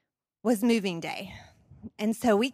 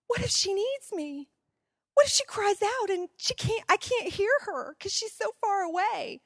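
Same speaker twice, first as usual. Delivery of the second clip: anxious worry; 8 semitones higher than usual. Retold or imitated. Night panic about daughter.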